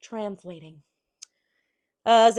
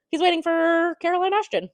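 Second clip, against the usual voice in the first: mock innocent; 9 semitones higher, 4 dB louder than usual. Suspicious character's excuse voiced.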